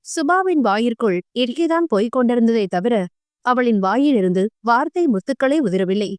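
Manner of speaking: usual speaking voice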